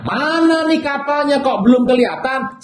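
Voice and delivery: high, puzzled whale voice peering for the ship; lightly voiced whale wondering where the boat is; impatient whale. Whale waiting for Jonah's ship, puzzled and high.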